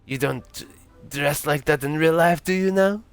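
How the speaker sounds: put-on accented question climbing high at the end; drunk French Santa. Drunk Santa quizzing the driver on clothes, rising lilt. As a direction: challenge it with a tipsy rising lilt. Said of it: "it's a drunk French Santa".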